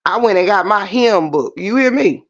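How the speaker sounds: normal talking voice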